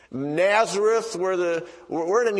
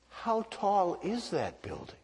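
slack-jawed awe; 3 semitones higher, 8 dB quieter than usual. Retold or imitated. Recruit gaping out the window at a tall building, imitated slack-jawed awe. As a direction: imitate an awestruck innocent, hushed. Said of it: "his mouth was slack".

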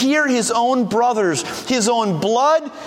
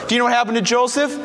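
usual speaking voice

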